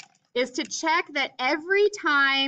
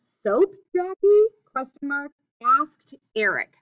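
reading the sentence aloud; 3 semitones higher, 7 dB louder than usual. Shown as a demonstration. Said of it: "this whole statement is read as one".

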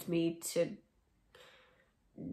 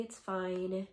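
ordinary talk

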